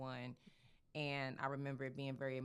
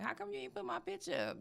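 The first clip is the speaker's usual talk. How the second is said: very high, quick mimicked whine of a client; mock complaint; 3 dB louder, faster than usual. Client asking makeup artist about her picture, mock high complaint. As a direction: put on a squeaky, hurried complaint.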